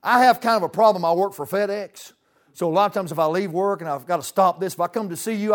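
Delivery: ordinary talk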